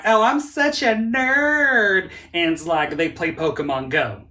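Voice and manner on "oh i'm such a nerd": high, slow mocking voice that drags each word; mocking quote. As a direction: mimic a self-proclaimed nerd, much higher and louder. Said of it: "people are like".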